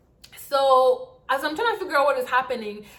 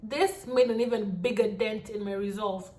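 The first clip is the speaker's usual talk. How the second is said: usual speaking voice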